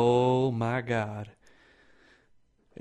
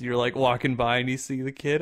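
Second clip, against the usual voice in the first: ordinary delivery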